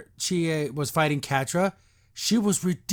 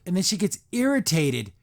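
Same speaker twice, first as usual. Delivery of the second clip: usual speaking voice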